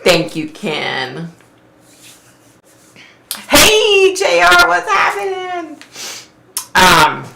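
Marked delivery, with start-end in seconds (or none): 3.5-5.8 high cheery greeting. Real, not acted. Greeting a viewer joining.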